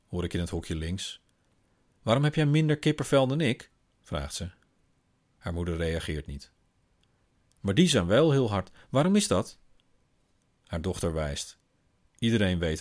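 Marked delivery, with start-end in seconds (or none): 2.0-3.7 put-on high, questioning child voice; curious little girl. Daughter asks her mother, high and curious. 7.6-9.6 put-on high, louder child question; curious little girl. Daughter points and quizzes her mother, high and insistent.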